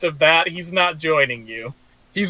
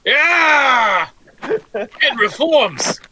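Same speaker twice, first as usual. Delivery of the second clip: loud, high, excited cheer; excited shout; 9 semitones higher, 3 dB louder, much slower than usual. Real, not acted. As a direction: shout it out in delight. Cheering the rebuilt party.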